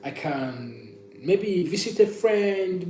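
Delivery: normal talking voice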